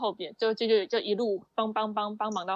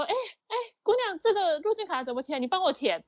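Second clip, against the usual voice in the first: calling out; 8 semitones higher than usual. Retold or imitated. Elderly passenger calling attendant.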